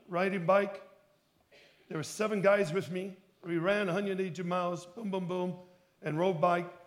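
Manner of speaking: usual speaking voice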